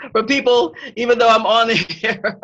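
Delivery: real laugh running through the words. Real, not acted. Host apologising to viewers for notifications during the live, laughing.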